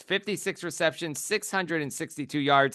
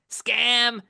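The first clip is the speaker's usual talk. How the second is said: high, loud cry of scam; mock outraged yell; 7 semitones higher, 3 dB louder than usual. Jeering at the busted pick, mock outrage. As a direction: jeer it out like a wronged fan.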